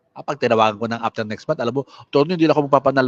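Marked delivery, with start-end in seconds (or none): 2.0-3.1 fast, eager client reply; rushed client. Retold or imitated. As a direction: blurt it out quickly as the client.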